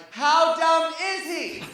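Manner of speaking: high, slow mock call from the audience; comedian's crowd response. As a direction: call it out like a crowd feeding a setup. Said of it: "But as a comedian, I was like".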